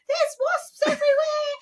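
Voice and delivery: high shrieking cry; mock panicked scream. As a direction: scream it like a terrified mum. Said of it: "she was like".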